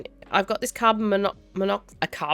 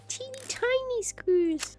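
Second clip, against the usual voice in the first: put-on much higher, slow, cutesy baby talk to the screws; squeaky tiny-voice coo; 6 dB quieter, slower than usual. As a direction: coo at the teeny tiny screws like they're babies. Cooing at tiny screws again.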